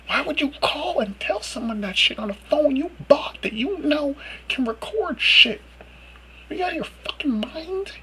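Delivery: high, whispered disbelief; stunned whisper. Real, not acted. Rant ends in whispered disbelief.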